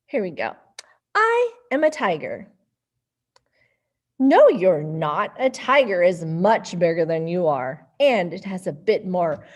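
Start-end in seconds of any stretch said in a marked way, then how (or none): 1.1-9.4 put-on lively storybook voices for kids; reading to children. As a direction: read it playfully to little ones. Reading picture book to kids.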